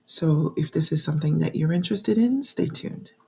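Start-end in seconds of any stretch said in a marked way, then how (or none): none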